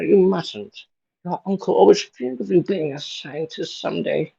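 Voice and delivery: put-on high-pitched old lady; put-on elderly aunt, pitched well up; old woman voice. Aunt May urging Peter to stay in school, high old woman voice. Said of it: "my generic old woman voice".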